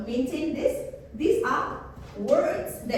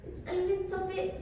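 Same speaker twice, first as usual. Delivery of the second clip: high, softened little voice for LITTLE; LITTLE said high and dainty; small voice; 9 semitones higher, 3 dB quieter than usual. Shown as a demonstration. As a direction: pitch LITTLE up to show smallness. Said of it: "this is how you do it".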